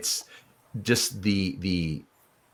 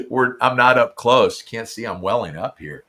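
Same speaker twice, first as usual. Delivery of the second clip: ordinary talk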